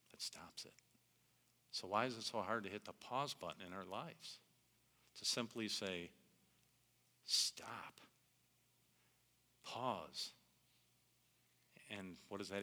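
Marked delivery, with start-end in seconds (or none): 0.1-0.7 whispered repeat. Shown as a demonstration. Pastor to congregation, whispering as the music stops. 7.3-10.3 whispered command. Shown as a demonstration. Pastor urging the congregation to pause, in a whisper. Hitting the pause button in life.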